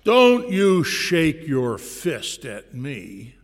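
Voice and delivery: slow, loud, menacing warning from the dog; growling dog. Acted. Dog warning the pastor who holds up the chain, growled slowly and loudly. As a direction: let each word drag out as a threat, loud. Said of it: "The dog growled".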